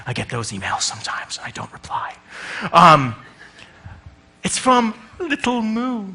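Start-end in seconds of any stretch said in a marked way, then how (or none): 0.1-2.2 unvoiced whisper aside. Real, not acted. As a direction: whisper it to the audience. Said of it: "whispered aside".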